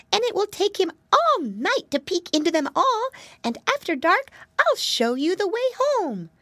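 high, squeaky storybook mouse; helpful little mouse. Acted. Mousy girl reassures the hiding piggy boys, squeaky voice.

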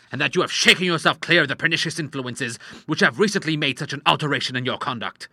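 firm, indignant paternal tirade; angry doctor father. Acted. Father threatening to leave for his club, angry tirade. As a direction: scold with stern indignation.

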